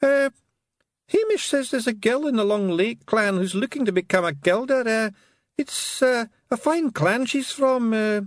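high, hesitant bashful Scots pixie; embarrassed Scottish pixie. A narrator's reading. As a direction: stumble shyly over a crush. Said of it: "going violet with embarrassment".

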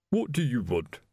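coldly disdainful butler; frosty servant's challenge. A narrator's reading. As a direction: ask it coldly, in a changed voice. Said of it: "said the butler coldly".

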